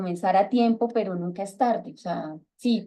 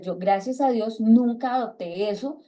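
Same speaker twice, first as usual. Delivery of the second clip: ordinary talk, nothing marked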